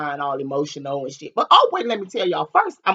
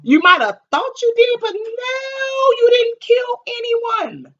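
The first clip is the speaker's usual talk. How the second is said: much higher and louder, the last words slowed right down; insisting loudly; 6 dB louder, slower than usual. Retold or imitated. Telling her sister she never killed anyone, high and loud. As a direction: stretch out you didn't kill anyone to get through to her. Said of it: "I'm like".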